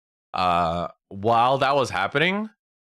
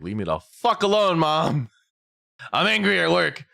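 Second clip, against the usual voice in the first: raised, higher pitched mock rage at mom; retold angry yell; 7 semitones higher than usual. Telling mom to back off, retold raised angry yell.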